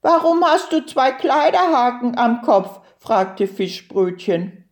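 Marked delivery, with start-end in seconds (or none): 0.0-2.8 high, quick questioning turtle voice; curious little turtle. A narrator's reading. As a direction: ask eagerly as a puzzled turtle.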